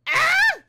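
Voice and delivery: one high piercing scream; test scream. Shown as a demonstration. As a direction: scream at the top of the voice. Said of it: "the same screams".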